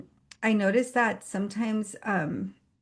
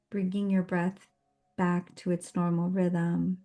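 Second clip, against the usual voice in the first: guided meditation. Real, not acted. Host grounding a meetup group before the session, guided meditation. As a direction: guide them gently through each breath, unhurried.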